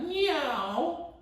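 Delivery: high mewing cat sound; playful high cat call; cat meow. Shown as a demonstration. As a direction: meow like a little scared cat. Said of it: "That's the scared cat".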